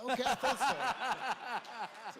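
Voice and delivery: slow, loud, laughing mock-smug reply. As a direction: drag it out, cracking up at your own joke. Retold own cocky reply, laughing.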